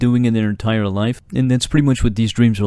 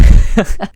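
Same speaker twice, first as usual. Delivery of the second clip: higher, louder breathy bully laugh; high mock cackle; 9 semitones higher, 9 dB louder than usual. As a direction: cackle down at the latecomer. Teacher laughs at late student.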